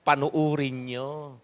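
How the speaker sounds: very slow, stressing panuurin; slow emphasis. Shown as a demonstration. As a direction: stretch out panuurin to make them look. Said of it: "at tingnan ninyo". (mabagal na diin sa panuurin)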